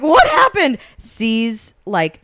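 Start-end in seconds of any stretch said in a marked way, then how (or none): 0.0-0.8 very high, loud squeal through laughter; hammed-up shocked cry, half laughing; mock shock. Teller playing mom finding her dead husband, comic shrill shock.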